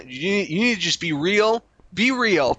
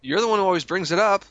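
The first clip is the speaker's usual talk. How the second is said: normal talking voice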